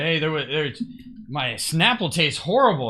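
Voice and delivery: indignant customer impression, voice pushed up; retold angry customer. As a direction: imitate a customer complaining angrily. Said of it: "he looked all angry".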